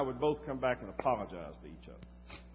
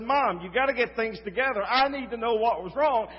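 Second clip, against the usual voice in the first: higher, louder, urgent demand; retold pushy son, louder and higher; a touch belligerent; 10 semitones higher, 7 dB louder than usual. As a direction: press her hard out of worry. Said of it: "getting a little almost belligerent".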